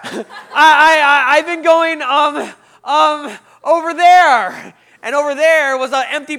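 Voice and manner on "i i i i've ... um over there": loud, high, flustered stammering; drawn-out, hammed-up fluster; panicked stammer. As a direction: grope for a lie at full volume. Caught lying, stammering to greeter.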